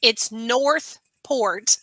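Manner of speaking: NORTHPORT very slow and loud with both R's sounded; R's pronounced. Shown as a demonstration. Co-host correcting the host's dropped R's, over-clear. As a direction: spell out each R in NORTHPORT, drawn out. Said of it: "you don't use your R's".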